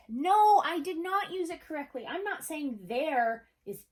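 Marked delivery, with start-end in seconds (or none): 0.1-2.1 NOT said high and loud; stress on NOT. Shown as a demonstration. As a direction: land hard on not. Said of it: "you guys are totally right".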